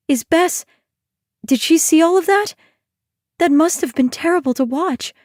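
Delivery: worried Ione. Acted. Ione's voice.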